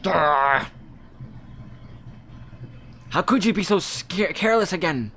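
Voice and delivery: high, loud furious scolding; angry growl. Acted. A villager scolding the cook for spoiled meat, high furious yelling. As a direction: tear into him for his carelessness.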